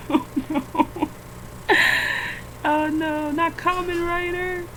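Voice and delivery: high, whining no; mock-wailing protest; wailing no at the screen; whiny high protest. Real, not acted. Whining at AI image result.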